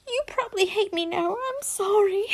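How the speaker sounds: high, fast tearful voice; a touch of fake sobbing; mock crying.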